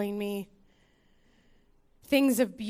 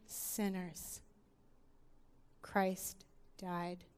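quiet preaching; 4 dB quieter than usual. Acted. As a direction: draw them in gently, almost hushed. Soft reflective sermon opening.

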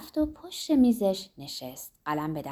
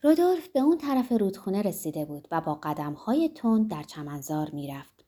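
ordinary delivery, nothing marked

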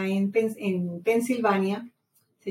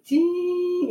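high sung beep like a heart monitor; flatline beep; 9 semitones higher than usual. Retold or imitated. Describing feeling dead, imitating the monitor in a high sung beep. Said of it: "que se ve la línea así".

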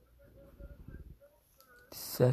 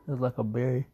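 ordinary talk

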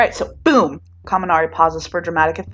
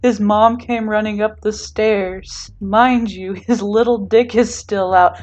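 laughing through words; 4 semitones higher, 5 dB louder, slower than usual. Acted. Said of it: "doubled over from laughter".

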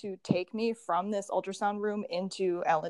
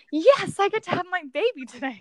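much higher, louder gushing imitation; mock eager mother; 9 semitones higher, 7 dB louder than usual. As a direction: parody an overjoyed mum-to-be, squealing. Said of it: "used to women just being like".

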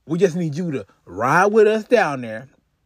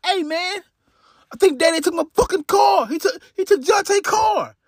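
imitating Eric, pitched way up, rushed; agitated phone call; 10 semitones higher than usual. Eric telling brother Darius their dad took the car, high and frantic. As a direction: blurt it out high and fast to his brother. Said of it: "Darius, like".

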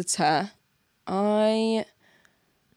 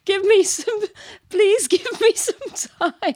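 pleading; 11 semitones higher, 4 dB louder than usual. Pretend. Pleading jokingly for time.

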